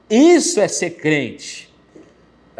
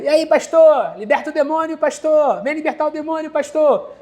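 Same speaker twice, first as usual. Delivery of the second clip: much higher, taunting possessed shriek; mocking shrieking women; 9 semitones higher than usual. Two possessed neighbors taunt the pastor, shrill mocking shriek. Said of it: "As duas gritando".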